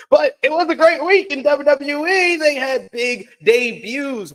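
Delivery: bright high hype; excited. Real, not acted. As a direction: burst into upbeat hype. Switches to hype.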